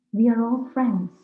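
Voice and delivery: ordinary talk, nothing marked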